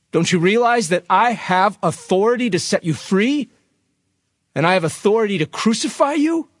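high, incredulous questioning; indignant challenge. Acted. Pilate confronts Jesus who won't answer, indignant. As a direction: assert your power over a silent prisoner. Pilate asserts authority over silent prisoner.

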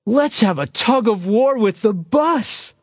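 high, stretched-out rallying cry; mock shout. A narrator's reading. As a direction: call it out slowly to the whole crew. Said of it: "they shouted".

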